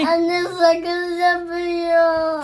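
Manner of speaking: put-on slow, sing-song baby talk; babyish singsong. Daughter pretends gum turned her into a baby, slow babyish singsong. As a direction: stretch it out like a tiny baby. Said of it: "Bebek mi yaptı seni?".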